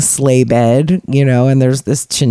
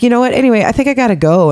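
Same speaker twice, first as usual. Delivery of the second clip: hurried exit; 8 semitones higher, much faster than usual. Retold or imitated. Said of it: "So I was like".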